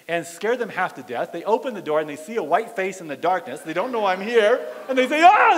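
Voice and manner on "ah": high, loud yelp of fright; mock terrified shriek; startled scream. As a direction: imitate someone screaming at a ghost. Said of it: "They think I'm a ghost".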